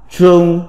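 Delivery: "Trương" on a level Mandarin tone; Mandarin reading. Shown as a demonstration. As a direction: say "Trương" as the Chinese syllable, held level. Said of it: "Phiên âm tiếng Trung đọc là".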